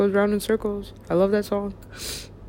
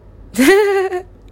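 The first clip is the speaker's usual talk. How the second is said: high, loud burst of laughter; 13 semitones higher, 9 dB louder than usual. Real, not acted. Laughing alone at their own joke, high loud laugh.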